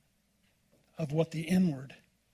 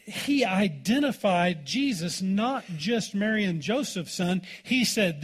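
ordinary talk

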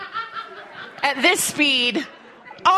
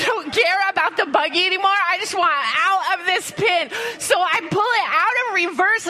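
ordinary delivery

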